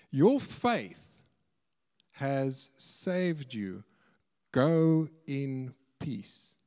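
very slow, deliberate pronouncement; solemn pronouncement. Retold or imitated. Preacher quoting Jesus to the forgiven woman, slow and solemn. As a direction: pronounce each phrase slowly, with long pauses.

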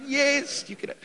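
high, exasperated yes; sing-song tired parent; dad giving in; mock parent reply.

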